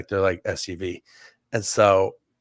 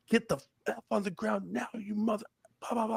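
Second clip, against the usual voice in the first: very high but softer mock yell, trailing off; retold cop yell; 11 semitones higher, 8 dB quieter than usual. Narrator recalling the officer's order, high half-volume mock yell. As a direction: replay the cop's order in a high, quieter voice. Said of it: "screaming at us".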